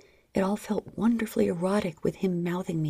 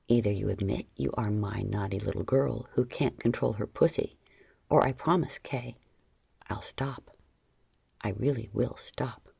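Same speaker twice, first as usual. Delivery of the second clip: stern low voice; 9 semitones lower than usual. A narrator's reading. John threatens, low.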